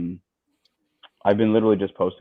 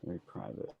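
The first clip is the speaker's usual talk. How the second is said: hushed echo; 4 semitones lower, 22 dB quieter than usual. Pretend. Echoes guest's "very private" in jest.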